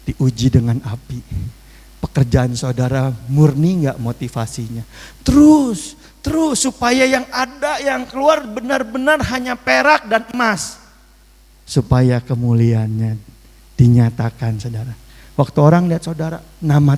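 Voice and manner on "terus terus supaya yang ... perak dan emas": preaching climax. Real, not acted. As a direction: call it out with fervor. Climax of the sermon.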